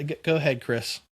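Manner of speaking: ordinary delivery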